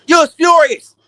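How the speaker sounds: loud, high outburst; practically yelling it at the fans; emphatic. Real, not acted. Ranting to fans who watched the fight, loud and emphatic.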